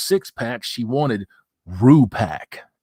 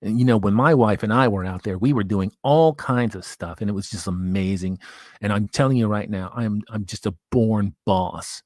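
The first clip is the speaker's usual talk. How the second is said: mocking the man's bragging, voiced a little lower; imitating Jack Murphy; 4 semitones lower than usual. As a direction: lower voice, lightly imitating a smug talker. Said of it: "This is how this man talks".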